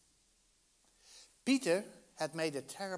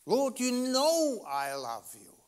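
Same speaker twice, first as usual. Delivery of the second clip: higher, slow, pleading as Peter; upset plea; 7 semitones higher, 4 dB louder, slower than usual. Retold or imitated. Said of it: "he gets terribly upset".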